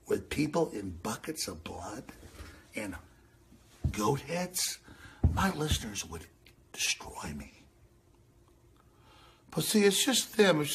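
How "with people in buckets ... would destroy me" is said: fading to whisper. Acted. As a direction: lower and softer, fading into a whisper. Fading into a hushed aside.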